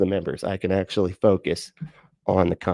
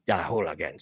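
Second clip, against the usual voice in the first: lower, softer bad Irish accent; terrible Irish accent; 4 semitones lower, 6 dB quieter than usual. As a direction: try a half-hearted Irish accent. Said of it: "that was a terrible accent".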